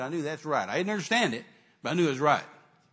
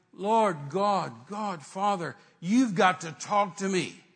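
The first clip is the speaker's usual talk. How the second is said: loud, higher-pitched, drawn-out appeal to God; demanding prayer, raised voice; retold insistent plea; 4 semitones higher, 6 dB louder, much slower than usual. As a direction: call on God insistently, stretching the words.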